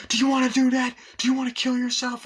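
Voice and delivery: high, loud, exasperated yelling; angry scolding. Acted. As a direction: shout sense into a reckless friend. Friend scolding the daredevil.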